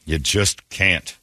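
ordinary talk, nothing marked

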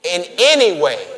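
congregation-rousing declaration; with life in your lungs. Shown as a demonstration. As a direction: call it out with conviction. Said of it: "Say it like you got life in your lungs".

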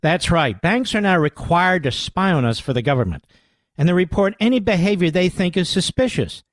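host urgently pitching a book to listeners; ad read. Acted. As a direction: sell it like a radio host ad. Reads a sponsor ad.

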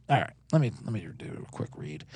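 ordinary delivery

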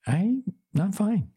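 very high, drawn-out mock fine; high-pitched pretence that all is well; unconvincing insistence. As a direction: squeak out a slow, unconvincing denial. Insists she is fine when not.

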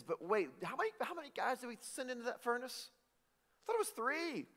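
surprised king. Retold or imitated. King in the furnace story.

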